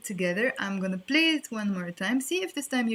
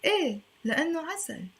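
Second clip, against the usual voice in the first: high, sing-song eh then a sweet reply; playful answer; 9 semitones higher, faster than usual. Retold or imitated. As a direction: coo it like a smitten lover. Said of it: "We can understand it".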